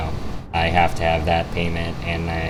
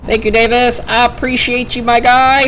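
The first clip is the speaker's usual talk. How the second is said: excited high voice; 7 dB louder than usual. Acted. Thanking a friend, hyped.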